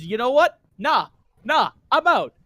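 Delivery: mock refusal. As Naruto walking out on the creepy voice, mock defiance. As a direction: refuse loudly, each word set apart.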